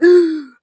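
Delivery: loud sharp mock gasp. Imagining Dumbledore reviving, a loud sharp gasp. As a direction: gasp as if brought back to life. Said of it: "expected him to be like".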